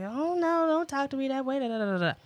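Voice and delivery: high, huffy mock scolding; a hammed-up huffy parent; mock upset reaction. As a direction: imitate an offended parent snapping back. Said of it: "I didn't get instantly upset and like".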